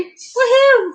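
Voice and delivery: high whoop of joy; gleeful cheer. Real, not acted. Celebrates finding lids.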